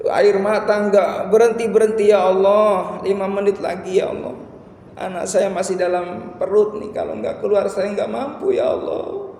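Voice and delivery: high, pleading mock-tearful prayer; tearful plea retold. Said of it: "lalu doa sambil nangis".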